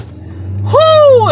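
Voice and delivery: long high "whew" of relief; high drawn-out whew. Real, not acted. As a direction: let out a loud, high whoop of relief. Relief after the nightmare night.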